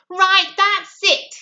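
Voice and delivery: fed-up prince shouting at his mother; shouted. A narrator's reading. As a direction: bellow it out, fed up. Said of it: "he shouted at the Queen".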